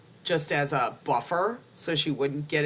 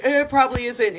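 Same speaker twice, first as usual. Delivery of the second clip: doctor voice; 10 semitones higher, 8 dB louder, faster than usual. Retold or imitated. Voicing the doctor.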